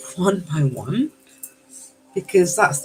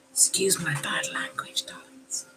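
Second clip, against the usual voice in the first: whispered, almost secret apology; 11 dB quieter than usual. Real, not acted. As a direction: confide it in a whisper. Apologising again to viewers.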